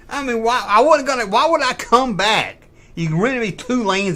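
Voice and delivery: high, loud incredulous protest; indignant outburst. Retold or imitated. Banned customer arguing with the lady, high loud indignation. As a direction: re-enact arguing back, voice shooting up. Re-enacts her indignant comeback.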